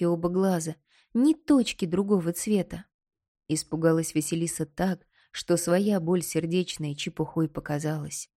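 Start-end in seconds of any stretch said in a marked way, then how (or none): none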